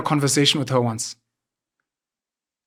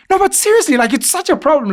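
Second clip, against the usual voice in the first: mock fussy girl; 12 semitones higher, 4 dB louder than usual. Imitating the girl again.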